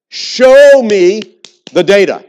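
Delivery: preacher voicing a doubter who wants proof, loud and slow; mock skeptic demand. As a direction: throw down the challenge slowly, loud. Voices an imagined skeptic's challenge.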